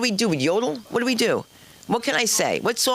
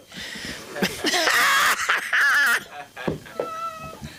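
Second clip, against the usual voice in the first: high, long burst of laughter; 11 semitones higher than usual. Real, not acted. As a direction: cackle helplessly at your own joke. Bursts out laughing at own bit.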